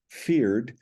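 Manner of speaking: normal talking voice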